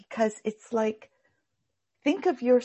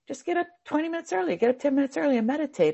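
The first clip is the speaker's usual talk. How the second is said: Kriya Ananda; 6 semitones higher, faster than usual. Retold or imitated. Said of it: "Kriya Ananda would say".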